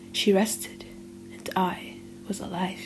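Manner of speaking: ordinary delivery, nothing marked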